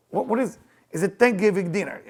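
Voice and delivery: normal talking voice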